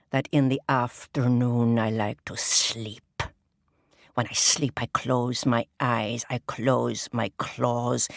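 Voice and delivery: slow, low, proud crab king. Acted. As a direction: lord it over the pond in a slow low voice.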